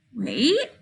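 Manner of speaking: wait. softly with a rising tune; questioning wait. Shown as a demonstration. Teacher demoing question mark, soft rising ask.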